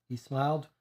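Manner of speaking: usual speaking voice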